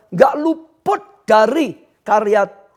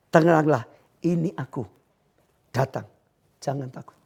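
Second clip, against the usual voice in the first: soft, low and hushed, fading to a whisper; calm reassurance; 6 semitones lower, 6 dB quieter than usual. Acted. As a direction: soothe the frightened ones gently. Jesus speaks to the listeners. (suara lembut menenangkan)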